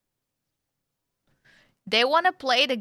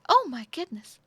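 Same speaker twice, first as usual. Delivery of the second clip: very excited; 6 semitones higher, 4 dB louder than usual. Shown as a demonstration. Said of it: "She's very excited".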